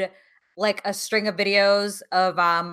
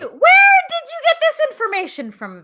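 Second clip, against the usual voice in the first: high, loud, stunned question; shocked disbelief; 4 dB louder than usual. Real, not acted. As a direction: demand the source in shock. Shocked by the panic attack news.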